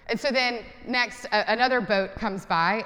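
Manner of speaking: ordinary talk, nothing marked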